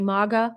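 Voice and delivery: "maga" said MAH-ga, British open back vowel; MAGA with broad ah. Shown as a demonstration. Giving viewers an example of the British coming out, broad-ah "maga". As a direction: say "maga" with a long ah in the first syllable. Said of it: "I say".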